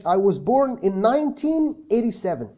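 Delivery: I was born in nineteen eighty-seven, very slow and clear; slow model sentence. Shown as a demonstration. Teacher models a full sentence for the class, very slowly. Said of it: "قولها nineteen eighty-seven".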